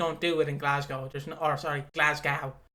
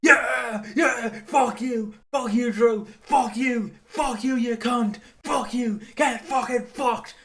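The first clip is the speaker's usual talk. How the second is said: much higher and louder mock rage shouting; yelling at the TV; 8 semitones higher, 8 dB louder, slower than usual. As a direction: scream abuse at the wrestler on screen. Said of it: "and I'm like".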